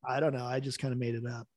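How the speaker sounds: lightly voiced author brushing it off; offhand author. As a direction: toss off the excuse quietly. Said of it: "the author was like".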